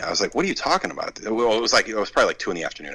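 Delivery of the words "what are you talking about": higher, incredulous what are you talking about; retold disbelief. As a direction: sound stunned, asking why.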